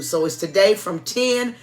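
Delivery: ordinary talk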